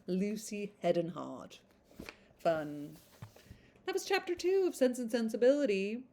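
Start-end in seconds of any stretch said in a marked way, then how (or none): none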